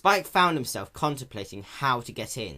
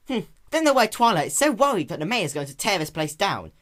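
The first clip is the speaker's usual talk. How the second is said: put-on high, louder boyish voice; young dragon voice; 8 semitones higher, 6 dB louder than usual. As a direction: muse aloud with a smug tone. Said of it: "Spike commented".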